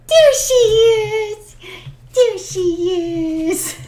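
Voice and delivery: high, slow drawn-out coo. Real, not acted. Owner cooing slowly at her puppy, high pitched. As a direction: stretch it out for the puppy.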